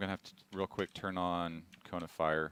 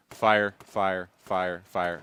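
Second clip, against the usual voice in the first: evenly spaced, separate beats on each "fire"; measured single shots; 6 dB louder, much slower than usual. Shown as a demonstration. Developer demonstrates how to shoot long-range weapons, spaced and punchy "fire". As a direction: land each "fire" as its own deliberate shot. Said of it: "the idea behind those weapons is to".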